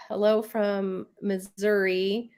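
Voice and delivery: usual speaking voice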